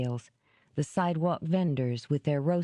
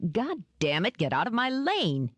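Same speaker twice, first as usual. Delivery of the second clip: shouted; 7 semitones higher than usual. A narrator's reading. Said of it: "he shouted".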